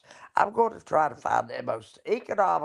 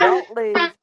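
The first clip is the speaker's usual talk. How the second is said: mock recorded warning; 10 dB louder than usual. Quoting the phone recording.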